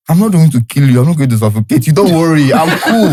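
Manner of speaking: high-pitched mock reassurance breaking into a laugh; retold pleading reassurance. Man reassuring his sleepless girlfriend he won't kill her, in mock protest. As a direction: insist you're harmless, pitch climbing, amused.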